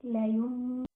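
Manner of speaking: ordinary talk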